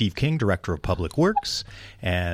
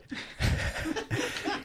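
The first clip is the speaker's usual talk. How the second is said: laugh; 10 dB quieter than usual. Real, not acted. Laughing at the name mixup.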